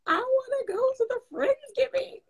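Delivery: put-on high, pleading childlike whine; whiny little kid. Stuck in hospital, begging to join Friendsgiving, high kid whine. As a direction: beg like a small child. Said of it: "And I was like".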